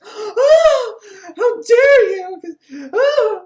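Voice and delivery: high, loud, tearful cry of outrage; a wailing melodramatic gasp of indignation; shocked shriek. Acted. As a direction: shriek it like a slapped heroine. Slapped woman reacts in outrage.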